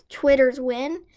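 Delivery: usual speaking voice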